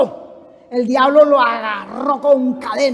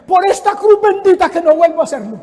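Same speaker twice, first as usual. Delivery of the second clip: higher, mock-solemn oath; vowing husband; 4 semitones higher, 6 dB louder than usual. Taxi driver swearing to his wife, high insistent mock oath. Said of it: "le decía a la mujer".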